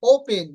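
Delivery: "ওপেন" loud, stressed o-u and aspirated p; correct pronunciation. Shown as a demonstration. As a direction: punch the first syllable of "ওপেন". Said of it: "not ওপেন". ("ওপেন" ওউ-ফেন-এর মতো)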